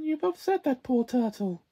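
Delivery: ordinary talk, nothing marked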